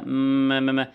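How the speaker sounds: م hummed and repeated as mm-ma-ma; repeated م syllables, lips closing each time; favourite sound repeated. Shown as a demonstration. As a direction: say م over and over like a child. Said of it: "يقول حرف ميم كتير".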